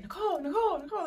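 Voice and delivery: high, repeated name-calling, imitated; mock urgent rousing of a patient; worried doctor calling. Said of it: "my doctor was worried".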